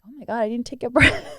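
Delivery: laughing realisation. Retold or imitated. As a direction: blurt it out with a laugh at yourself. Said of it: "I'm like".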